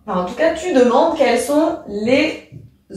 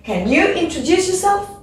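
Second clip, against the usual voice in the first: yourself? lifted at the end; rising question; 8 semitones higher than usual. Shown as a demonstration. Teacher modelling a closed question, rising tune. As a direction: rise on yourself?. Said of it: "on monte l'intonation".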